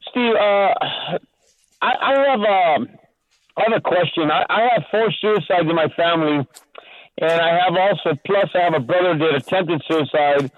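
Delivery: usual speaking voice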